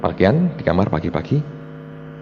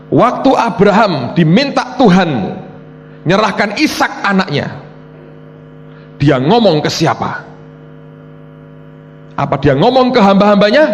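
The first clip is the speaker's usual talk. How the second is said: God; 9 semitones higher, 4 dB louder than usual. Retold or imitated. Preacher voicing God questioning him about Abraham, emphatic questioning. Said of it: "Tuhan ngomongnya gini".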